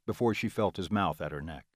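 ordinary delivery

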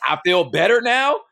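high, mocking parody of her words; sarcastic sing-song mimic.

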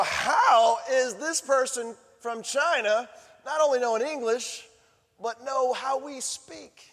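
astonished. Pretend. Astonished hometown listener, imagined.

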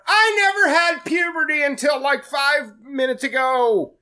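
high, loud confession yelled to the room; shouting across restaurant. Retold or imitated. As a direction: belt it across a crowded restaurant. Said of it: "he shouts across the restaurant".

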